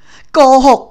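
high, very loud cry of "liar"; a jealous heiress shrieking at the heroine; screaming accusation. Acted. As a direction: hurl the word at her. Rival calls heroine a liar.

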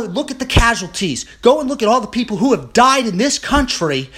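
building from firm to a loud, high furious rant; real outrage, shouting it at the cowards. Real, not acted. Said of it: "this is where I get furious".

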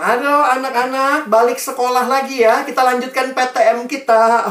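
high, loud schoolteacher voice; calling teacher. Acted. Teacher calls pupils back to school, loud summons. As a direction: round up the class loudly. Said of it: "Gurunya bilang".

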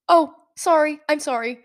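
loud, high startled "oh", then easing off; flustered apology. Retold or imitated. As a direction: blurt out an embarrassed retold apology. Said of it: "I was already so flustered".

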